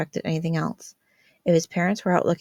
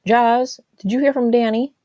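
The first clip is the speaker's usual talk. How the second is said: loud call from another room; 6 semitones higher, 10 dB louder than usual. A narrator's reading. Jack calls to Jazz through the house, loud.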